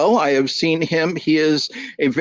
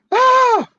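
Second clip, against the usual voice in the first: very high, loud shriek of shock; startled high scream; crowd scream; 5 dB louder than usual. Acted. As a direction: shriek in horror at the soup.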